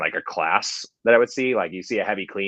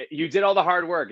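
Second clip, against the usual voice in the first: "you did all the hard work" said higher in a teasing sing-song; teasing a friend about no reps, "you did all the hard work" in mock praise; mock flattery; 10 semitones higher than usual. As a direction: tease the lifter with fake praise. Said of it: "I gotta say".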